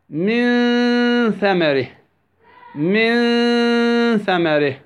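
من ثمره with the n of من drawn out as a hum; ikhfa with ghunnah. Shown as a demonstration. Tajweed teacher gives an example, long humming ikhfa in من ثمره. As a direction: hold a hidden nasal noon before the thaa in من ثمره. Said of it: "والإخفاء".

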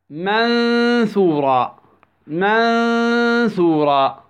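منثورا slow, with a long hummed n; ikhfa with ghunnah. Shown as a demonstration. Tajweed teacher gives an example, long humming ikhfa in منثورا. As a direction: hold the half-hidden noon before the thaa in منثورا. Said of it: "والإخفاء".

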